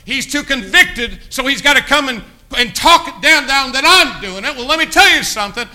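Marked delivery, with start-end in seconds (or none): 0.1-4.6 loud mocking jab. Real, not acted. Mocking the quitter.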